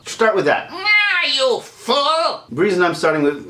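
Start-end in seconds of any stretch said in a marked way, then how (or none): 0.7-2.4 very high villain cry, drawn out; Skeletor. Shown as a demonstration. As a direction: stretch the nah into a high cartoon villain voice. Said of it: "who Skeletor is".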